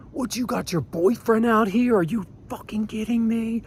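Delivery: very high, held-back mock yell; retold yelling. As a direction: taunt in a high, strained imitation of yelling. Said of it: "Like, I'm still yelling".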